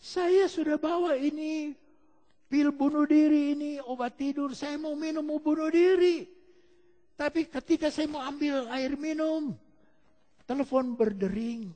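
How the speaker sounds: high-pitched tearful confession, acted; imitating a crying woman. Desperate woman confesses to her friend on the phone, mock tearful. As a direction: plead it out high, as if weeping. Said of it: "Dia nangis. "Kenapa kamu nangis?".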